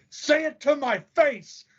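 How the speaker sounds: high, drawn-out mock challenge; taunting dare. America squaring up to a trash talker, taunting dare. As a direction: dare them to step up, pitched high. Acts out America daring a rival.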